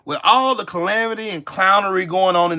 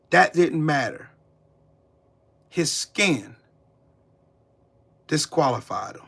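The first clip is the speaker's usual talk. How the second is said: usual speaking voice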